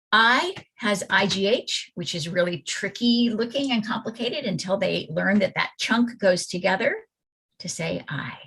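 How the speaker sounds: ordinary delivery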